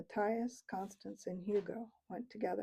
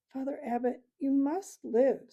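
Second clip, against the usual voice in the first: gruff, choking plea; 6 semitones higher, 6 dB louder than usual. A narrator's reading. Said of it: "in a gruff, choking voice".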